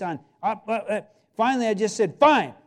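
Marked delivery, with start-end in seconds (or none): 2.2-2.5 loud, high, clipped give-in; exasperated shout. Retold or imitated. Preacher yielding to the Lord with a loud fine. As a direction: bark it out in defeat. Grudging surrender to God.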